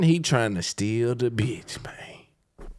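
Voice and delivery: hushed warning; whispered aside. Real, not acted. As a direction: whisper it like a secret. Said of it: "whisper aside".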